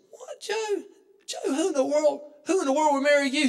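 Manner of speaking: very high, incredulous squawk; shocked disbelief. Acted. The men reacting to Joe's wedding news, high shocked squawk. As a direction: gasp out the question in disbelief.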